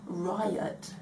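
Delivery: ordinary talk, nothing marked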